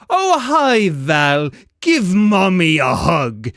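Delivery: loud, slow, sickly sweet menace; monstrous mother luring her child into a hug; creepy monster mom. Acted. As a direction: lure the child in, then pounce. Monster mom lunges at player.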